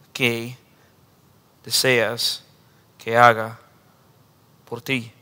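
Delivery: soft, slow, tender asking; a calm, caring voice of Jesus; gentle question. Acted. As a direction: ask kindly, word by word. Jesus asks gently, slowly.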